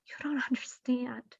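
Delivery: whispered, hushed plea; pleading whisper. Retold or imitated. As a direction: whisper it as if it hurts. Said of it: "Other times".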